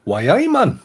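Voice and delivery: hearty Geordie exclamation, pitched high; Geordie accent. Acted. Englishman showing co-hosts Sunderland talk, Geordie accent. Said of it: "I do love the Geordie accent".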